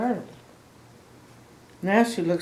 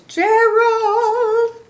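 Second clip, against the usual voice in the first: high sung call across the room; calling out Gerald; 9 dB louder than usual. Acted. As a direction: call his name up high and loud, singing it. Piggy calls out to Gerald.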